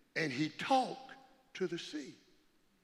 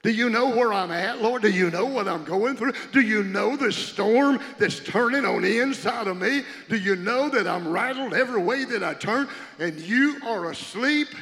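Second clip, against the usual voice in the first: louder pleading cry, asking the sleeping Lord; anguished plea to the Lord; 3 semitones higher, 7 dB louder than usual. Acted. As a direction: lift it up as a desperate prayer. Preacher voicing plea to Lord.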